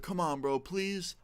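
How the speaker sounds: mild retold begging, lifting high on the last word; mock plea that climbs at the end; pleading. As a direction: plead lightly, imitating a begging friend. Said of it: "he's begging me".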